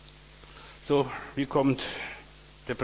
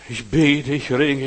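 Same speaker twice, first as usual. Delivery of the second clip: crying out; 9 dB louder, slower than usual. Acted. Jesus voiced in Gethsemane.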